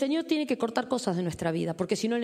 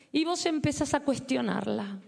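normal talking voice

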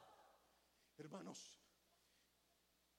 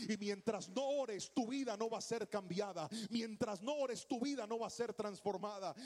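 preaching at full force; 9 dB louder than usual. Acted. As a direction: drive the point home to the congregation. Preaching climax.

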